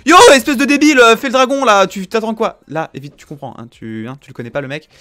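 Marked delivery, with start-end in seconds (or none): 0.0-2.6 loud, high mock insult; insulting yell. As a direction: bark it at a teammate. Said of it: "ne l'insultez pas, tu fais pas genre".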